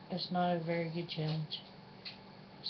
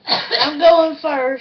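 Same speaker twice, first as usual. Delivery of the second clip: loud; 8 semitones higher, 16 dB louder, much slower than usual. Real, not acted. Daring herself to dance.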